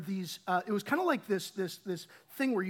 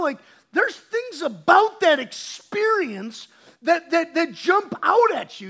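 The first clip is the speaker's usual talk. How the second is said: louder, pressing preacher's push; preaching at full force; 5 semitones higher, 9 dB louder than usual. Acted. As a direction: drive each line home from the pulpit. Preaching hard about the captive girl.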